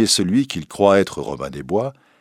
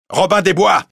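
appel crié, plus haut et plus fort; hèle Robin de loin; cri; 12 semitones higher, 7 dB louder than usual. A narrator's reading. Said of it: "crie l'évêque". (l'évêque crie le nom de Robin dans la forêt)

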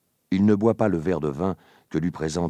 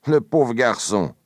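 mildly put-on sympathy, louder; feigned pity; 5 semitones higher, 7 dB louder than usual. A narrator's reading. Danglars pretends to pity Fernand, mock-sympathetic. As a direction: pretend to pity the young man. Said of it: "en feignant de plaindre le jeune homme".

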